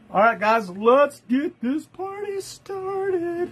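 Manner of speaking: drawn-out, high party-starter call; hype kickoff. Real, not acted. Host firing up live viewers, high drawn-out hype. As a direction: rev up the crowd like an emcee.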